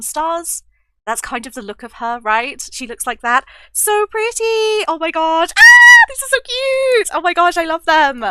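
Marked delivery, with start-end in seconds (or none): 3.7-5.5 high, drawn-out delighted gush; swooning, high-pitched excitement. Real, not acted. Gushing over pretty stickers. 5.6-5.9 very high, loud squeal; short piercing squeal; delighted squeal. Real, not acted. Squeals over cute stickers. 6.1-8.2 high, loud squeal of delight; giddy, high-pitched gushing; thrilled. Real, not acted. Squealing over cute stickers.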